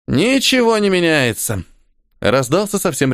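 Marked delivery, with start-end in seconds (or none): 0.1-1.6 high slow gleeful exclamation; Khali voice. A narrator's reading. Khali repeats motto, delighted.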